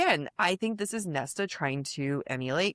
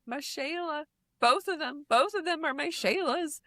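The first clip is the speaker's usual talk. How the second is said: meme voice; 9 semitones higher than usual. Retold or imitated. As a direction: sing it out like the internet meme. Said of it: "we call it my Shayla 'cause they were quoting someone".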